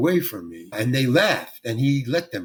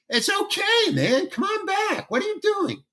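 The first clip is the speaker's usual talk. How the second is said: much higher, light friendly call, imitating Clint; mock Clint calling him back, pitched way up.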